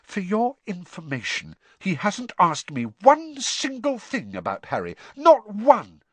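high, hurt indignation; upset, building to emphatic; quivering voice. A narrator's reading. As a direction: defend yourself, wounded. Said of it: "her voice quivered".

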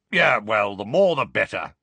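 grim old auror, lightly voiced; dark, gruff Moody. A narrator's reading. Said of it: "said Moody darkly".

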